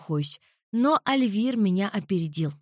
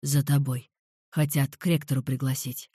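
lower, darker man's voice; low male voice; 7 semitones lower than usual. A narrator's reading. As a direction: voice him low and calm. Alvir speaks.

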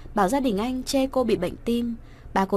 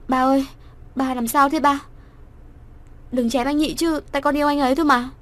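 higher, louder frightened plea; frightened daughter; 5 semitones higher, 4 dB louder than usual. A narrator's reading. Frightened daughter begs her father not to strike, higher pitched. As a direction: beg her father in panic. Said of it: "Cô sợ quá".

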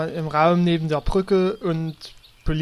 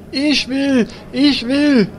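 high, louder eager repetition; 8 semitones higher, 6 dB louder, slower than usual. Acted. Patient eager for the diagnosis, high eager repeat. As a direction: beg for it like a kid who wants a turn.

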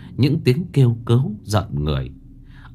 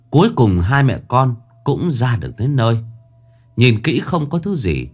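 ordinary delivery, nothing marked

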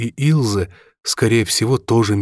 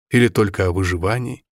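ordinary delivery

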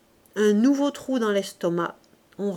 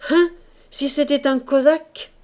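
a mild patriotic cry, a little higher and louder; cried out; 5 semitones higher, 6 dB louder than usual. Acted. Lorilleux bursting out at the table, lightly raised cry. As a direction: call it out with a hint of patriotic fervour. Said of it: "Il cria".

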